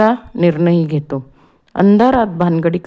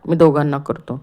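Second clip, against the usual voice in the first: plain reading voice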